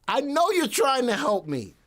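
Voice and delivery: loud, high-pitched protest after a laugh; hammed-up pleading, voice way up; playful exasperation. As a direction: shout it in mock exasperation. Playful exasperation at Janice's nagging.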